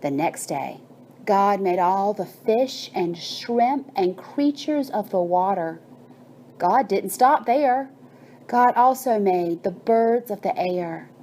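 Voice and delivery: ordinary delivery, nothing marked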